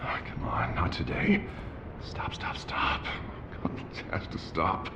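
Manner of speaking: hushed, unvoiced self-talk; frantic whispered muttering, barely audible; desperate whisper to himself. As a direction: beg yourself under your breath to make it stop. [name] muttering to himself alone.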